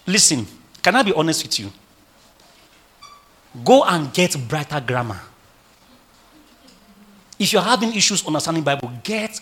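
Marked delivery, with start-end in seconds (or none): none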